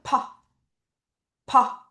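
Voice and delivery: 퍼 as a light, airy puff of p; airy P sound. Shown as a demonstration. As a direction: let a small breath out on 퍼. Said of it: "바람 소리를 이제 머금으면서".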